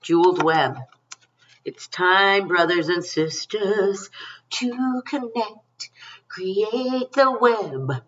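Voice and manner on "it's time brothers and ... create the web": dreamy, drawn-out invocation. Acted. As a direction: stretch each word out like a spell. Dreamy card message to viewers.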